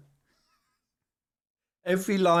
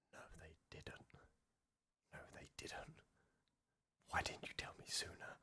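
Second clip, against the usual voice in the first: shocked whisper; 26 dB quieter than usual. Real, not acted. Streamer stunned by a reveal, gasping whisper. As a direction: gasp then whispered disbelief.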